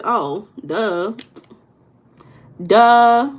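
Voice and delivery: loud, stretched duh at herself; mock duh. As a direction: scold yourself playfully, dragging duh. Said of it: "I'm like".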